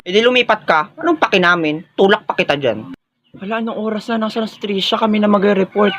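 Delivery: ordinary talk, nothing marked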